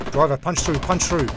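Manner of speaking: loud, high command to the driver; urgent shouted order. A narrator's reading. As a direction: shout it over the gunfire. Ordering driver through an ambush.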